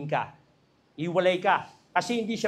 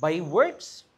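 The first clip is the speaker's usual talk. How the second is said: indignant disbelief. Retold or imitated. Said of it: "gigil na gigil ako".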